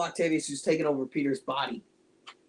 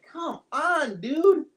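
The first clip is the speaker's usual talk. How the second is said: higher, teasing disappointment; exasperated; 8 semitones higher, slower than usual. Retold or imitated. To the filmmakers, higher mock exasperation. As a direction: come on, nudge them to do better. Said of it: "And it was just like".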